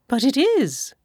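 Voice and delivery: a lightly voiced eager Mrs Bennet. A narrator's reading. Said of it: "returned she".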